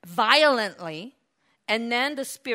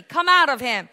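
raised, stern command; 4 semitones higher, 8 dB louder than usual. Acted. Jesus casting out the spirit, stern. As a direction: order it out sternly. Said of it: "said Jesus sternly".

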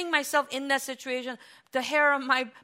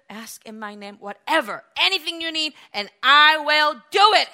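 building to a shout; 3 semitones higher, 8 dB louder than usual. Retold or imitated. Preacher building the Lord's promise to a shout. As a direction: start hushed, build up to a loud shout. Preacher quotes Lord rising.

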